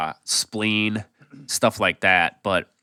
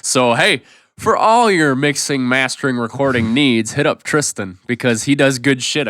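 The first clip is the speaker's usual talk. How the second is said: hyped ad plug; 5 semitones higher, 6 dB louder than usual. Pretend. Mock ad plug for Tristan.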